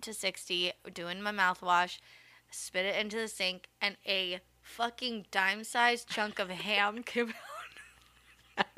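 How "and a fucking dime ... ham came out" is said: laughing whisper. Real, not acted. Ham chunk story punchline.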